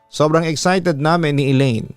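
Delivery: ordinary delivery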